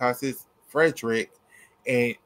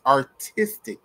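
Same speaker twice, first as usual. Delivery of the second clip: deliberate emphasis on "artistic"; stress on artistic. Real, not acted. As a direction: make "artistic" the point, syllable by syllable. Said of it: "Emphasis on artistic".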